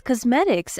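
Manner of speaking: ordinary delivery, nothing marked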